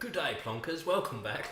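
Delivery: lightly put-on English accent greeting; Pommy accent impression. Friend imitating a British YouTuber for the stream, light English accent. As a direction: ham up a British YouTuber's hello. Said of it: "You want me to talk like Drews".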